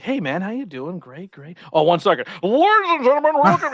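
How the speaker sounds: mock the switch into announcer voice; wrestling announcer parody. Mocking wrestlers who switch to an announcer voice. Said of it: "talking to guys like".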